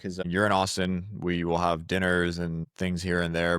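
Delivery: ordinary delivery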